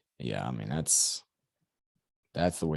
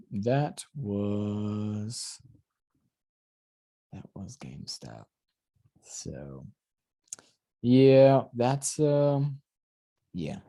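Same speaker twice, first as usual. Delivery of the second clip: drowsy, drifting voice; half asleep and trailing off; much slower than usual. Real, not acted. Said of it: "being half asleep".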